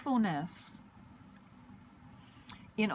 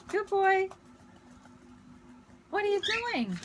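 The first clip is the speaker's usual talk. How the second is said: much higher, sing-song coaxing pet voice; calling the bird; 11 semitones higher than usual. Real, not acted. Much higher coaxing voice calling the bird Artie. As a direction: coax the bird onto your head, high and sweet.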